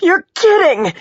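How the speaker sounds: high, loud shout. A narrator's reading. Injured patient to questioning detective, shouted. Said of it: "she shouted".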